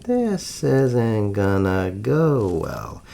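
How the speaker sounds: slow, low, drawn-out musing; inner thought. A narrator's reading. Powell to himself at the suspicious butler's door, wary musing. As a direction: stretch it out as a sinking realization. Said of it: "Powell figures".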